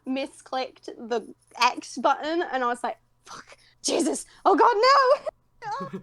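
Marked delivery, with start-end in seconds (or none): 3.2-6.0 slow, rising, whimpering wail of panic; panicked cry. Retold or imitated. After misclicking the button during the premiere, panicked wail. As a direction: wail it like you just made a fatal mistake.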